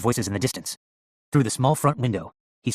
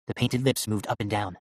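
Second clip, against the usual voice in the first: usual speaking voice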